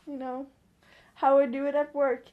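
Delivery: normal talking voice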